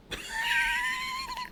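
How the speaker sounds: real burst of laughter; high shrieking laugh. Real, not acted.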